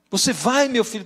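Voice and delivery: light up with hope as the mother; mother thrilled her son will quit; delighted mother. Retold or imitated. Mother delighted at son's promise.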